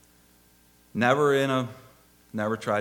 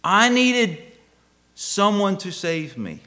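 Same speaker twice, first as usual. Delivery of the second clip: raised, emphatic preacher's cry easing at the end; impassioned cry; 9 semitones higher, 8 dB louder, slower than usual. Real, not acted. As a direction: burst out high and loud, then slow down. Preacher climaxes on need for rescue.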